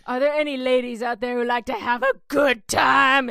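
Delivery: a hint of a showy frontman; lightly hammed-up stage banter; mock rock singer. Frontman teasing the crowd, lightly mocking.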